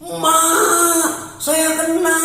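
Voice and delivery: high, loud cry for mum; loud scream. Retold or imitated. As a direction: yell it out high from the bedroom. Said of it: "ada teriakan keras dari kamar". (teriakan keras bernada tinggi)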